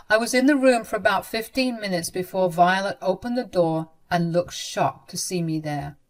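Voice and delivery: ordinary talk, nothing marked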